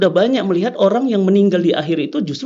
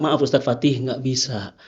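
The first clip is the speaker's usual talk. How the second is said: quiet apologetic refusal; 8 semitones lower, 6 dB quieter than usual. Retold or imitated. Policeman refusing the request, retold.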